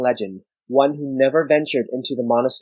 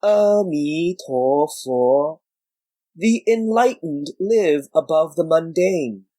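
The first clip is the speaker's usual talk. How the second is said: pious recitation; 4 semitones higher, slower than usual. Acted. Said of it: "Wang Geng recited".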